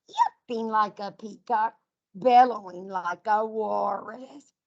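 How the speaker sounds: sing-song picture book read to a class; reading to children. Acted. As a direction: read it out like storytime for little kids. Teacher reads the final list.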